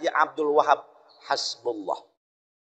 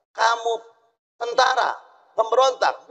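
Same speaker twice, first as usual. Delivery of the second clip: mock Dutch officer; 7 semitones higher than usual. Dutch officer interrogating villager.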